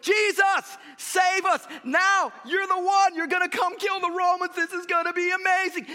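high, loud pleading yell; crowd shouting. Retold or imitated. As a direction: cry out to Jesus like a frenzied crowd. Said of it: "These people say".

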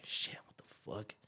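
hushed, scared whisper; whispering in the dark, alarmed; whispered curse. Retold or imitated. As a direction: breathe the curse out in fear. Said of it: "I was like".

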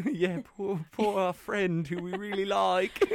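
put-on high, slow English accent; mock English accent. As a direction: ham up an English accent, stretched out. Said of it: "an English accent".

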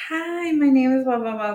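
high, singsong canned greeting; lady's introduction spiel. Retold or imitated. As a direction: imitate a rehearsed, overfriendly introduction. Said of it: "she had that whole spiel".

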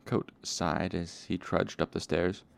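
plain reading voice

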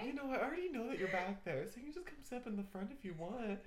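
soft seductive murmur; sultry narrator. Retold or imitated. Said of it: "I tried to do it, like, in a seductive voice too".

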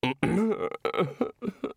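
high, broken whimper; small wordless whimpering. Acted. Senior wrangler overcome at her question, whimpering. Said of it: "whimpered the senior wrangler".

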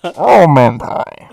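loud, slow put-on voice; mock voice. Cohost joins the bit, slow and loud. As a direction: drag it out in a silly voice.

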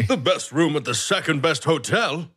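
mild mock outrage; indignant guest. Fuming guest berates the receptionist, indignant but contained.

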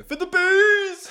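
very high, loud, drawn-out mock battle cry; rallying yell. Supporter driving alongside the skater, long high cheering yell. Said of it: "drive alongside you and just go".

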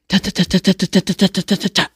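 high, rapid rat-a-tat; voiced machine-gun burst; evenly hammered high staccato gun noise; imitated gunfire. Imitates assault rifle fire.

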